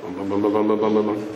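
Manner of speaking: low humming bum bum; imitated car engine. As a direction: hum a low engine throb.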